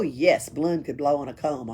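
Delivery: ordinary delivery, nothing marked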